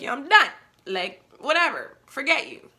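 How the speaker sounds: telling him off before walking away, loud and fed up; retold yelling. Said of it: "after yelling at me like that".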